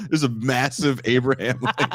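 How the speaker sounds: ordinary talk, nothing marked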